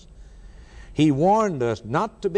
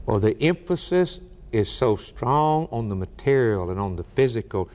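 usual speaking voice

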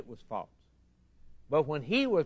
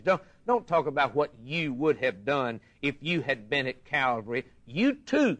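usual speaking voice

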